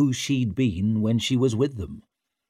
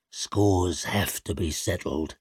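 low grim voice; 4 semitones lower, 3 dB quieter than usual. Acted. Shivers speaks.

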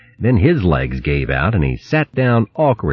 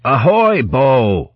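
high-pitched sailor's hail; calling out; 7 semitones higher, much slower than usual. A narrator's reading. Sailor calling from ship to hare ashore, high hail. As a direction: hail a mate on shore, pitched up. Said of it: "called Berg".

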